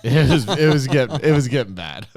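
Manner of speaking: talking through laughter; real chuckle breaking into the words; laughing. Real, not acted. Laughs while recalling computer trouble.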